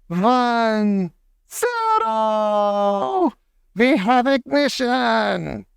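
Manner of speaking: the witch counts down to ignition; witch countdown. Acted. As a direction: count down with rising glee.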